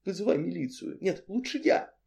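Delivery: soft, high urgent whisper; hushed quick second thought. A narrator's reading. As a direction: keep it secret and small. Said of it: "зашептала я в ответ".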